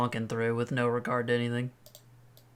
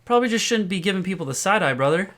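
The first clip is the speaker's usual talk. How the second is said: raised, swaggering street talk; tough guy warning; 7 semitones higher, 4 dB louder than usual. Acted. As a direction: warn him off, cocky and high. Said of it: "I'm like".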